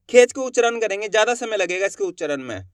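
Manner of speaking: ordinary talk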